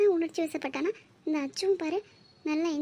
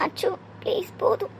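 whispered, broken plea; whispered begging through tears; tearful whisper. Acted. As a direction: breathe the words to him, pleading. Begging softly at the bedside.

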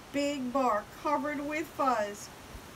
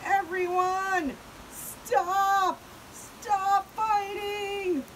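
high, loud call over a brawl; pleading shout; 8 semitones higher, 5 dB louder, much slower than usual. Acted. Pipsqueak trying to stop the fight, shouting. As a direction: stretch out a desperate order to stop.

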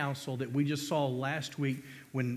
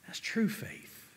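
hushed, breathy emphasis; 5 semitones higher, 5 dB quieter, slower than usual. Acted. Hushed climax.

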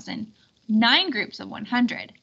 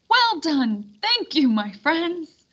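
raised, slow joyful praise; delighted thanks; 5 semitones higher, 4 dB louder, slower than usual. Acted. King thanking the performers, slow delighted praise. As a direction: beam at the crowd.

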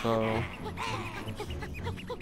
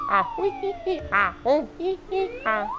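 high squeals. Pretend. Squealing nonsense sounds.

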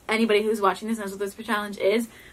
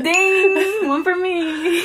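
high, loud game-show ding; triumphant; 8 semitones higher, 5 dB louder, much slower than usual. Real, not acted. Player claims a point after guessing right, triumphant and high. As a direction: crow over the point you just scored.

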